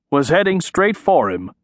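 plain reading voice

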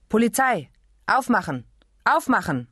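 barked commands through a door; stern police orders; police shouting. A narrator's reading.

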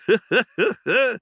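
high squeaky cackle; acted high, teasing mouse laugh; prankster's laugh. Prankster laughing at his cousin, high squeaky cackle.

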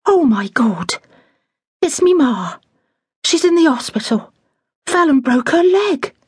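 high, panicked alarm over bad news; shocked cry. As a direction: cry out in sudden fright. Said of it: "she gave a little scream and said".

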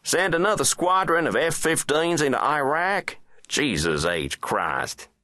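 high-pitched, exasperated presidential rant; scornful president. Acted. President mocking the Iraq bombing plan, high and scornful. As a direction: pour scorn in a high, forceful voice.

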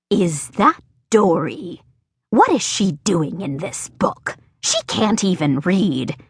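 a hint of a puzzled old farmer; lightly voiced farmer. Farmer spots Rascal in his book, mildly puzzled farmer voice.

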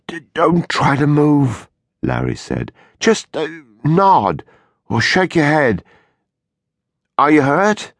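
high, careful urgent voice; anxious. A narrator's reading. Husband calming wife on floor.

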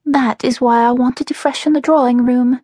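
high-pitched, wistful younger sister. A narrator's reading. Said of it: "The wistfulness in Melody's voice".

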